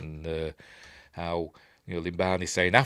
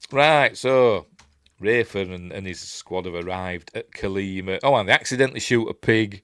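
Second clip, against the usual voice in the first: ordinary talk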